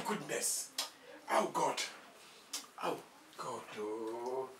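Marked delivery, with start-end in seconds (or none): none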